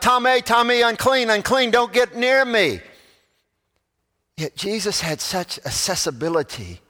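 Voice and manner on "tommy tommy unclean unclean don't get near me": higher, louder acted leper's cry; yelled warning. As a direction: call out an urgent warning to keep people away. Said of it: "the leper had to yell out".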